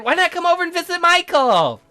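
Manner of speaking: high, loud, singsong cheer; gushing girlish invitation; perky girl character. Acted. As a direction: chirp it high and bright.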